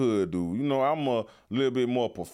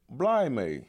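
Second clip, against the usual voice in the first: put-on British accent. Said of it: "I learned that word".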